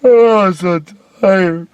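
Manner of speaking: hammed-up high weary moan; mock the idea as a whiny tired light. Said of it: "they call it tired light".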